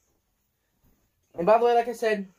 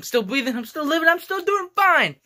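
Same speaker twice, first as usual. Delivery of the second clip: building up to a defiant, cheerful shout; rising excitement; 11 semitones higher, 6 dB louder than usual. Real, not acted. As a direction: voice climbing higher and louder. Insists he is still okay.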